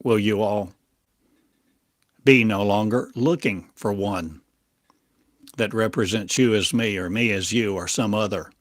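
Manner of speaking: ordinary talk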